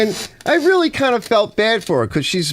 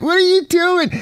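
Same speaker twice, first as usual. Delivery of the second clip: incredulous cry. Retold or imitated. Exasperated reaction to interruption.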